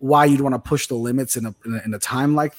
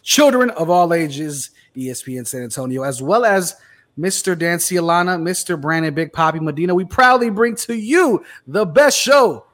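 big ringside announcer build-up; 8 semitones higher than usual. Acted. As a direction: boom it out like a ring announcer. Announcer show opening.

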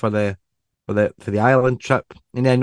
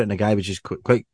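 ordinary talk, nothing marked

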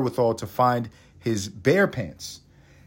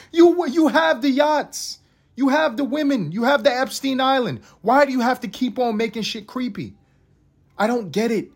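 pleading whine; 11 semitones higher than usual. Real, not acted. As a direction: comedian pleading in a high voice. Pleading rant at rich people.

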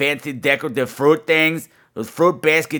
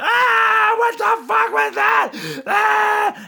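imitating a startled scream; much slower than usual. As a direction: shriek as the victim waking in pain. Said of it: "He went".

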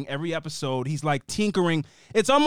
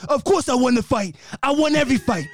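higher-pitched, worked-up fighter boast; C T impression; 5 semitones higher than usual. Imitating a reality star bragging after a fight, C T impression. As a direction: rant like an unhinged brawler. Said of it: "legit C T impression".

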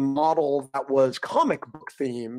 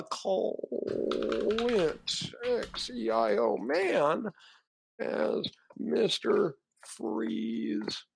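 put-on old-timey voice; 7 dB quieter, much slower than usual. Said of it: "you have to say it using an old-timey voice".

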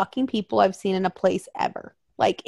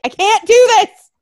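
much higher, louder, desperate; overwhelmed cry; 11 semitones higher, 7 dB louder than usual. Retold or imitated. Fleeing the store in panic, desperate cry. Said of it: "I'm like".

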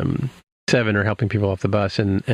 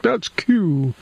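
a jolt of recognition, pitched way up; shocked realization; 10 semitones higher than usual. Retold or imitated. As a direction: blurt the name out high, stunned. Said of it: "He's like".

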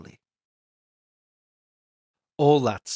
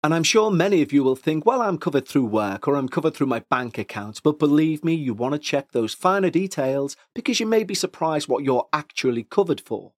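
upbeat, louder sponsor read; 3 semitones higher, 4 dB louder than usual. Acted. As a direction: sell it like a podcast ad.

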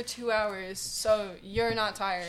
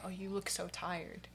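mock concern; 2 semitones lower, 14 dB quieter, slower than usual. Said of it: "people say".

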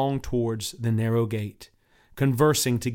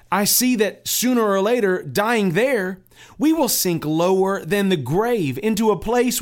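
high, loud pleading pilgrim; 8 semitones higher, 5 dB louder than usual. Acted. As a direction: urge the neighbors to come, raised and earnest.

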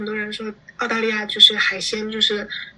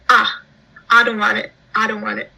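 Australian accent; 5 dB louder than usual. Shown as a demonstration. Imitating locals' relaxed Australian speech. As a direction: toss off the phrase like a relaxed local. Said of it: "它是啊".

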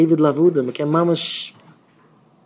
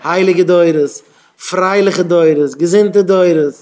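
loud, drawn-out fervent prayer; louder, higher, weighty appeal; fervent plea; 3 semitones higher, 7 dB louder than usual. Acted. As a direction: pray it out emphatically, slow and raised. Acted prayer to God for blessings.

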